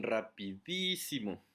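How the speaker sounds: "rapidísimo" with a long, drawn-out DÍ; stress on DÍ. Shown as a demonstration. Teacher giving another example, "rapidísimo" with DÍ held long. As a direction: lean hard on DÍ in "rapidísimo" and stretch it. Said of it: "la sílaba más fuerte, la tónica".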